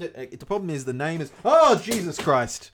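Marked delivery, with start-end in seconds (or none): 1.4-2.7 loud, drawn-out startled cry; startled exclamation. Real, not acted. Host cries out as the camera mount falls.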